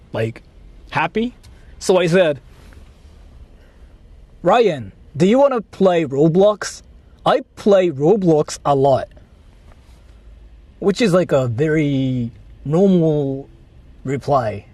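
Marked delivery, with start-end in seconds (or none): none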